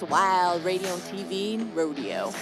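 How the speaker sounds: bright, high country radio host hype; twangy announcer pitch, over the music; radio host intro. Acted. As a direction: sell the show like a rowdy country DJ. Radio host show opener.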